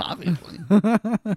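high, slow sarcastic drawl. Acted. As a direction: stretch it out mockingly. Sarcastic drawn-out agreement.

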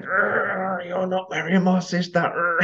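high, slow mock outrage; imitating a furious brother; mock protest. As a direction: drag it out like a gagged brother. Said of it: "he was going mad".

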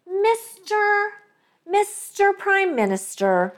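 high-pitched mock politician's address; Chrystia Freeland. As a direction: draw it out high, imitating a politician. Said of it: "there's more Chrystia Freeland".

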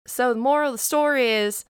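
declaring the moral of the tale; story narration. Acted. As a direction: wrap up the story with conviction. [name] delivers the story's moral.

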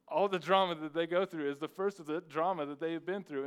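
loud laughing aside about middle school drama. Real, not acted. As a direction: laugh it out, amused.

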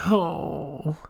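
high imitated moan of pain; mock pained groan. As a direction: groan like the injured cyclist. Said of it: "he was like".

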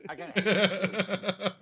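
drawn-out laugh through the words; slow, laughing delight. Real, not acted. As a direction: laugh it out slowly. Laughing after the bit.

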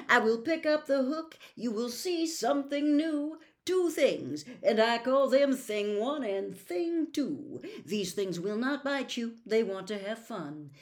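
loud grand showman cat. Acted. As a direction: present it like a ringmaster. Cat announcing Things.

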